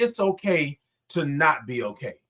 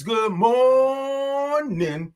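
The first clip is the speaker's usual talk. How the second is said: high, long-held morning call; drawn-out greeting; 7 semitones higher, much slower than usual. Real, not acted. Host greets James as he joins, drawn out high. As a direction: stretch that O way out, up high. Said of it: "I love all those O's and R's".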